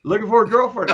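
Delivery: high, loud amused disbelief; surprised echo. Real, not acted. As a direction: echo it back in mock shock. Repeats the son's teasing remark.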